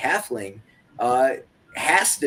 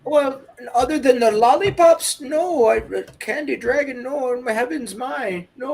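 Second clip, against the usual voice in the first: put-on high, fluttery old lady denial; flustered old lady; 9 semitones higher, 4 dB louder than usual. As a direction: fuss and deny like a flustered granny. [name] answers in character.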